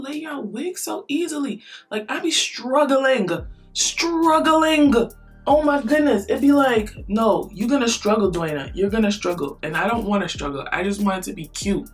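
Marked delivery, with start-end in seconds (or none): none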